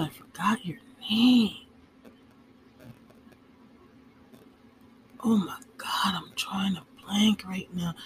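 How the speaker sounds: embarrassed unvoiced whisper. Real, not acted. Embarrassed aside, forgot name.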